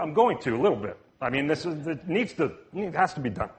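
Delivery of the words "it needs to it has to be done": usual speaking voice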